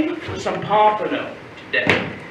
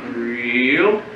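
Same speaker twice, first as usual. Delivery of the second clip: low, stretched REEL that rises; drawn-out coaching; 8 semitones lower than usual. Acted. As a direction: stretch "reel" slowly upward like winding line. Coaching each move of the line.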